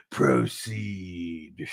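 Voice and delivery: slow, grand cave command; lightly voiced magic cave; Cave of Wonders voice. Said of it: "They used that same voice".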